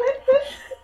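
high, loud burst of laughter; cracking up at the clip; a squealing fit of laughter. Real, not acted.

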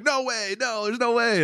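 very high, imitated protest; high-pitched, incredulous mock wail; mock disbelief. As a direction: mimic a beaten wrestler refusing to accept it. Retelling the loser's protest.

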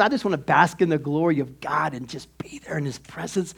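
hushed, breathy whisper to God; whispered prayer. Shown as a demonstration. A believer whispering a private prayer to God, soft whisper.

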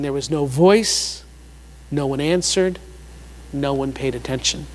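"no voice, no one answered, no one paid attention" low, in separate measured phrases; parent-like emphasis. Real, not acted. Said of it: "It sounds like a parent".

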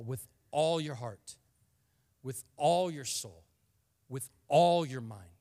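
slow, rising emphasis on all your heart, soul, mind; stress on ALL. Shown as a demonstration. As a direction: pound all in every phrase. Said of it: "I emphasize the word all there".

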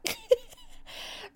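high shrieking burst of laughter. Real, not acted. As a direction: let out a squealing laugh. Laughter tag alone.

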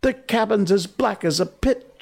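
high-pitched panicky report; almost gibbering. A narrator's reading. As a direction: babble it high, close to losing control. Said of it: "almost gibbering".

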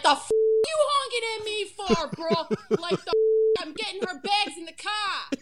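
loud, high mock-irate Boston accent; angry Boston accent. Imitating Boston friend yelling at a honking driver, angry accent. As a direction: go off like a Boston driver being honked at. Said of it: "The Boston friend was driving".

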